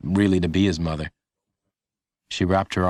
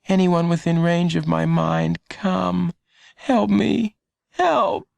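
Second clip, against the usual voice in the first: much higher, rising plea for help; desperate inner plea; 10 semitones higher, slower than usual. A narrator's reading. Dying Lenny calling silently for help, higher desperate plea. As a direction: beg with all his might. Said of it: "he willed the invisible people with all his might".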